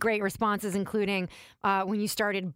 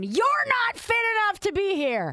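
higher, louder barked order; drill sergeant voice; 11 semitones higher, 4 dB louder than usual. Pretend. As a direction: bark it at a lazy recruit. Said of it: "Staff Sergeant Rita".

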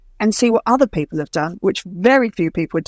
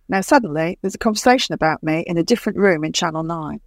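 ordinary talk

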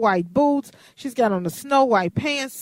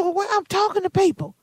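high protesting voice; 10 semitones higher than usual. Retold or imitated. Her own protest retold.

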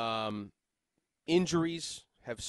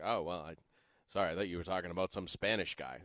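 low, soft deadpan coach; Bill; 10 semitones lower, 6 dB quieter than usual. Retold or imitated. Coach excusing himself to the reporter, low deadpan. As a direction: keep it low and quiet. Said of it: "He's like".

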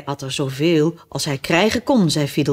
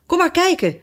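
high-pitched eager call; 12 semitones higher than usual. A narrator's reading. Brothers call Alice to come and look, calling out high. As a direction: call someone over, pitched well up. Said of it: "riepen de broers".

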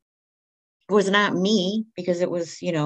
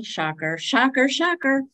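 rising higher each time, mock surprise; building sarcasm; sarcastic; 7 semitones higher than usual. Real, not acted. Woman joking about blacking out, rising sarcasm. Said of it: "sarcastic repeated remark".

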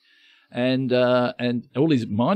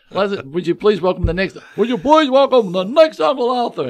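host voice, building higher and louder; hammed-up compere announcing contestants; Uncle Arthur voice; 8 semitones higher, 5 dB louder than usual. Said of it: "go out as Uncle Arthur".

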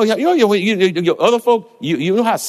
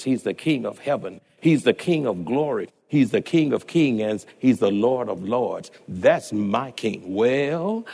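lower, measured oratorical recitation; sermon reading; 4 semitones lower than usual. Acted. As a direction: roll through the list of titles with steady cadence. Quoting Lockridge sermon in low cadence.

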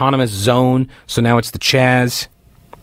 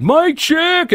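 high-pitched call to the crowd; shouting; 14 semitones higher than usual. Retold or imitated. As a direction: call it out like a protest mob. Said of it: "they scream it out".

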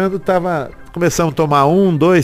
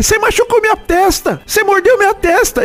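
much higher pitched accusing yell. Retold or imitated. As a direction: cry out the accusation, high. Shouting accusation at opponent.

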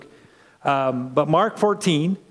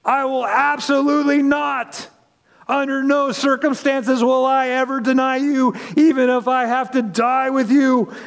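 higher, insistent vow; emphatic insistence; 8 semitones higher than usual. Acted. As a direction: swear it with proud certainty. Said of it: "replied emphatically".

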